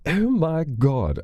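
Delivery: slow amazed oh my god; surprised. Real, not acted. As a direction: draw it out in shock. Reacting to Brummy voice actor.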